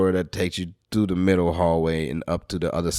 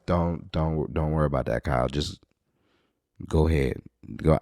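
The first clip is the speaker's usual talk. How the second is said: much softer, low urgent plea; hushed calming; 4 semitones lower, 7 dB quieter than usual. Retold or imitated. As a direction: quietly talk him down. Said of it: "she was like".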